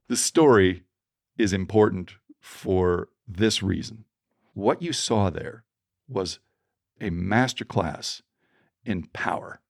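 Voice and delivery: slow emphasis. Real, not acted. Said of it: "slow emphatic speaker delivery".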